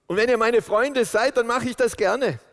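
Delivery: high and loud, full of fervour; fervent preaching. Acted. Preacher voicing Jesus to the congregation, fervent. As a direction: lift it up with conviction.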